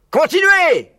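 cri beaucoup plus aigu et fort; hurlant. A narrator's reading. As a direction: hurler l'ordre aux autres fermiers. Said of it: "hurlait le gros Boggies". (Boggies hurle sur Buns et Bean, très aigu et fort)

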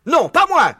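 very high, loud frightened yelp; panicked cry. Acted. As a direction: squeal it as you run. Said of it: "s'écria Buns en prenant la fuite".

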